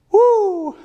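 very high, loud mock whoop of shock; startled whoop. Husband shocked by unmade-up wife.

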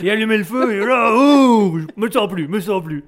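belting it out like a rock star; carried away, loud and wild; rock concert frenzy. Pretend. Rock fan fired up by Johnny.

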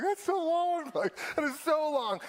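high-pitched, hammed-up childhood freak-out; mock scared kid. Preacher acting out his freaked-out younger self, high mock panic. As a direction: imitate a terrified kid, voice jumping way up. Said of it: "I'm scared. I'm like".

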